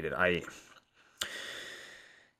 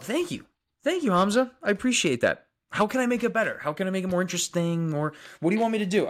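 excited thanks; 9 semitones higher than usual. Real, not acted. Thanking a viewer for a compliment.

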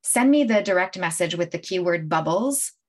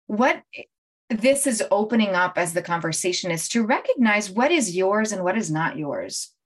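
usual speaking voice

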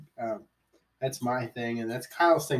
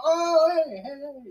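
jolted awake, high and loud then fading; startled old man; 14 semitones higher, 5 dB louder, slower than usual. Retold or imitated. Friend mimics the old driver jolting awake, high and startled.

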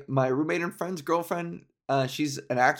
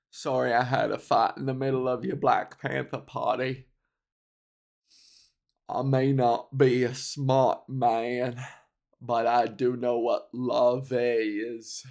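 Forrest Gump; 3 semitones lower than usual. Retold or imitated. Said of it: "the quote is".

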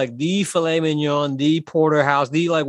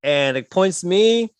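ordinary delivery

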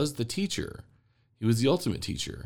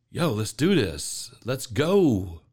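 hyped God; 3 dB louder, much slower than usual. Retold or imitated. God cheering a soul on.

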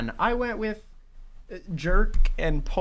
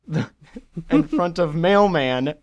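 laughing; 4 semitones higher, 6 dB louder than usual. Real, not acted. Laughs through the news read.

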